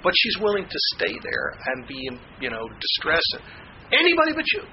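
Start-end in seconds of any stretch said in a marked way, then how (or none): 3.9-4.6 high, loud cry of refusal; desperate refusal. Retold or imitated. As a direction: fling it at him, pitched high and loud. Quoting the woman's refusal, repeated.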